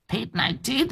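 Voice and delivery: put-on high, hissing eerie old hag; ghoulish crone. Grandmother demanding bloody raw meat, eerie and hissing.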